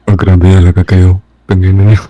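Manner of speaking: ordinary delivery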